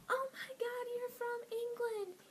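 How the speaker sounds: high, loud mock-starstruck squeal of questions; gasp, then gush loudly in a high voice, imitating amazed girls; excited gushing girls. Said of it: "this was their expression".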